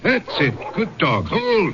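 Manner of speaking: single high command to a dog. Acted. Henri tells his dog to hold, high command. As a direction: hold him there, up high.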